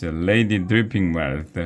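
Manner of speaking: ordinary delivery, nothing marked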